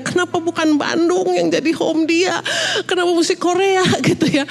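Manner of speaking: high-pitched laughing lament; plaintive protest. Retold or imitated. Mother hurt that daughter calls Korea home, plaintive protest.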